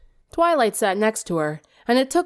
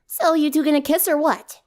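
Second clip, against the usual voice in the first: higher, teasing tomboy voice; brash Rainbow; 9 semitones higher than usual. A narrator's reading. Rainbow teasing the couple at lunch, brash and higher. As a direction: needle them with a blunt question.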